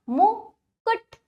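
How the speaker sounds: मुकुट high, split into मु and कुट; broken-up pronunciation. Shown as a demonstration. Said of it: "तोड़कर जोड़कर उच्चारण".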